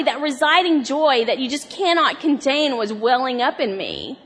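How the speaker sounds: usual speaking voice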